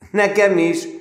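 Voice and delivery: a cheerful, loud call of agreement; laughing shout. A narrator's reading. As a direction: call it out gleefully across the room. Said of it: "kiáltotta Lackovics Sándor nevetve".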